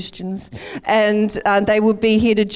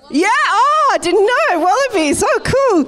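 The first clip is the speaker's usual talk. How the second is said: much higher, loud excited exclaiming; excited surprise; 12 semitones higher, 3 dB louder than usual. Real, not acted. Pastor reacting to the Wallabies win, high excited surprise. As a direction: gush over the news like it's huge. Congregation tells her rugby result.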